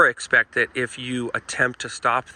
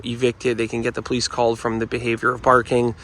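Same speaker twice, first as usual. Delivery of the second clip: ordinary talk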